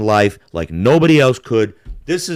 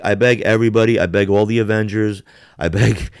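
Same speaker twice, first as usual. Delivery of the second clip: ordinary delivery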